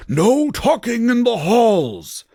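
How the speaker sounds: high, slow, hammed-up angry yell; angry shouting. A narrator's reading. Dumbledore scolding students from his broomstick, angry shouting. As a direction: holler it high, stretching every word. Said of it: "started shredding at us angrily".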